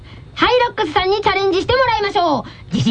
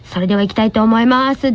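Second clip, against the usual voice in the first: ordinary delivery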